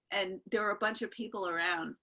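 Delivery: normal talking voice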